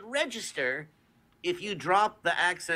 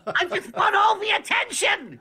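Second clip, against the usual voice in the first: attention-seeking shout; 12 semitones higher, 5 dB louder than usual. Pretend. Playing Daffy, joking.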